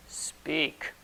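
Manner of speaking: low, soft command from a male presence; lightly voiced male spirit, quiet; masculine spirit voice. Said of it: "this masculine presence".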